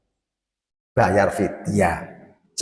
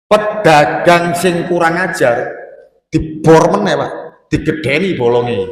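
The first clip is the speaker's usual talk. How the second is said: indignant preaching; 5 semitones higher, 5 dB louder than usual. Acted. Preacher denounces dishonest trader.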